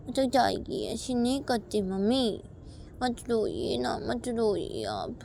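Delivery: put-on high child voice, gently coaxing; soothing little daughter. Child begging mummy not to cry while eating, coaxing. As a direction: plead sweetly like a small child.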